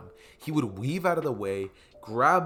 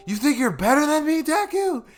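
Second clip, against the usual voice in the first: put-on high, loud childish yell; angry six-year-old Bakugo; 14 semitones higher, 8 dB louder than usual. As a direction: shout it like an angry little kid. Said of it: "I'm saying it like that because, you know, they're both six years old".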